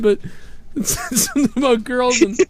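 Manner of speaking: high, giggly, still cracking up; laughing through words. Real, not acted. Host explaining the headline still laughing and high-pitched. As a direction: try to talk while laughing.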